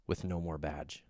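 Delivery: very low and soft admission; hushed confession. Retold or imitated. Repentant thief confesses, hushed and low. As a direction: breathe it out, broken. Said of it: "He's saying".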